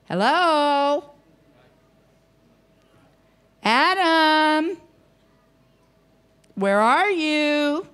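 put-on high, slow call, like searching for a lost child; a father calling his hiding child; calling out to Adam.

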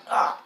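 short, loud "Ah!"; sudden yell. Real, not acted. Gamer attacking in the game, sudden loud yell. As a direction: let out one sudden shout.

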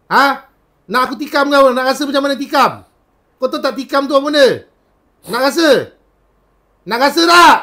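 loud, high threatening shout; furious threat. Acted. Healer threatening the spirit with stabbing, shouting. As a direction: threaten to stab, rising to a yell.

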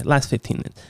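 normal talking voice

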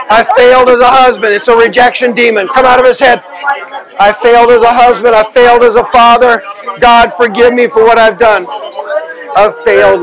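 fervent repentance prayer. Acted.

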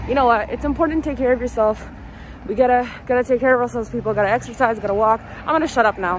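pep talk. Real, not acted.

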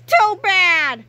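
high, loud gloating shout; gleeful shout. Acted. Krupp gloating at the boys, loud gleeful shout. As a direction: crow it with delight. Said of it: "Mister Krupp shouted with delight".

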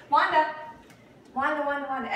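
loud high call; calling the puppy. Real, not acted.

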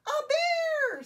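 very high, loud storybook scream. A narrator's reading. Eddie meeting a bear, screaming. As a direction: shriek it long in alarm. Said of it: "screamed Eddie".

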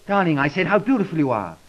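spoken up. Acted. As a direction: say it again so she can hear. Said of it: "Speak up, please".

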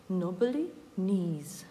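knobbly knees with no k sound; silent k. Shown as a demonstration. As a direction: say "knobbly knees" starting straight on n. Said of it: "Якщо слово починається на кн, то к не вимовляється". («knobbly knees» без звука к)

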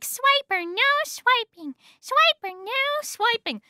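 high kids' cartoon chant; Dora and Boots. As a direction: call it out in a high, cartoon-hero voice. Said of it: "Dora and Boots and the frickin' backpack and map are like".

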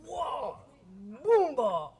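loud cartoon whack noise; mimicked cup smash. Pest throws a cup at the groom, loud whack noise. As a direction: punch out the impact sound. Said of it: "Coge la y hace".

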